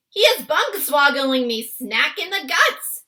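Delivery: loud, high-pitched giant's complaint; shouting. A narrator's reading. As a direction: shout as if just kicked in the belly. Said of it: "shouted the gizzled gulper".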